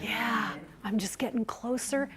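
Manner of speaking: dropping to soft. Shown as a demonstration. Trainer showing trainees how to draw them in, softly. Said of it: "It's getting softer and harder to hear".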